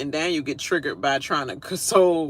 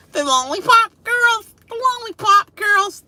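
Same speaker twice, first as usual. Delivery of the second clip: high, slow sing-song mockery; mocking sing-song; 6 dB louder than usual. Real, not acted. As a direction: drawl the nickname to tease them.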